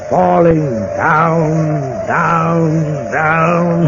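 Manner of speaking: slow, gloating drawn-out words; drawn-out menace. Acted. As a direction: savor every down slowly. Villain gloats over the fall.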